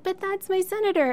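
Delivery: put-on high whiny kid complaint; whiny Anakin voice. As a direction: whine it like a sulky boy. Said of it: "did this kind of whiny Anakin voice".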